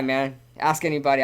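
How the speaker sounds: usual speaking voice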